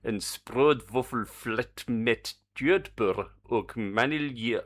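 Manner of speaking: slow, deliberate fake German accent; mock German accent. Streamer reading out the Danish ice cream name, mock German accent. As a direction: read it out in a put-on German accent. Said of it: "do it in a German accent".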